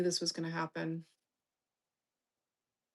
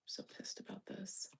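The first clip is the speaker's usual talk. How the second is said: unvoiced, much softer; frustrated whisper; 13 dB quieter than usual. Real, not acted. Streamer fuming over her stock in a whisper. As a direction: whisper your anger.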